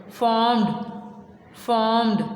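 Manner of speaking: फॉर्म्ड with the r nearly dropped; almost silent r. Shown as a demonstration. Teacher drilling फॉर्म्ड for the class, r near silent. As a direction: say फॉर्म्ड as "fomd", r barely there. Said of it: "आर की आवाज़ ना होने के बराबर होगी".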